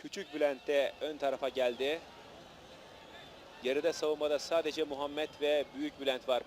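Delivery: usual speaking voice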